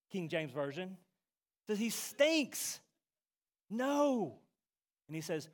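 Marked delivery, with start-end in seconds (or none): 1.8-4.4 higher-pitched, mock-disgusted objection; mock protest. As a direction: object with a higher, exaggerated recoil. Preacher acts out Martha's protest.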